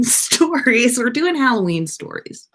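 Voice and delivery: ordinary talk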